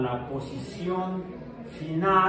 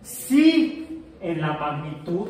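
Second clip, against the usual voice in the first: usual speaking voice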